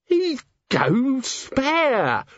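very slow, high-pitched wail; awed dread. Acted. Guest imagines his commander's fury, drawn-out dread. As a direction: stretch every word in appalled wonder.

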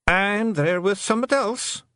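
plain reading voice

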